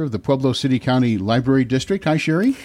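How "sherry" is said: ordinary talk, nothing marked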